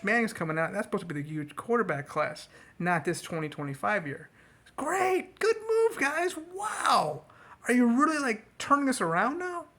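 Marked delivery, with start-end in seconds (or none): 4.8-7.2 high, mocking "great, good move"; sarcastic cheer at the team's front office; sarcastic praise. Real, not acted. Mocking team's draft decision. 7.7-9.7 incredulous. Real, not acted. Disbelief at team's moves.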